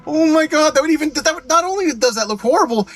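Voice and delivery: high, loud disbelief; incredulous. Real, not acted. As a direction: sound aghast at the stupidity. Shocked at backwards spoiler.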